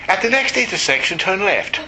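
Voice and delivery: mock the polite British navigator; wussy British GPS voice. Preacher mocking his GPS in a prissy British voice. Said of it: "that little wussy British voice".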